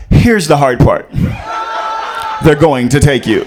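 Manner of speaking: low, loud, commanding; father on the phone, deep and loud; stern movie dad. Retold or imitated. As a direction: deliver bad news coldly and firmly. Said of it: "My dad was like".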